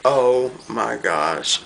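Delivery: normal talking voice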